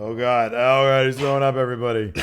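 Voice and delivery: loud, laughing alarm; mock alarm. Real, not acted. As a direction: sound the alarm for the room. Co-host coughs, mock alarm.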